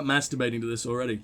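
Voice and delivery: usual speaking voice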